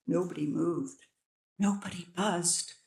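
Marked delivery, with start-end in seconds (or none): none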